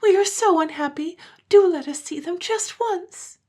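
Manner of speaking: put-on high childish begging; pleading children. A narrator's reading. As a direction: beg like unhappy little kids.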